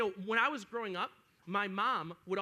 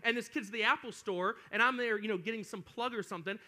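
ordinary talk, nothing marked

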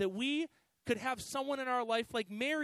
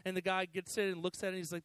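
ordinary talk, nothing marked

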